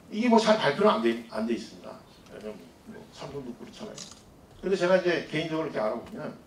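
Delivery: ordinary delivery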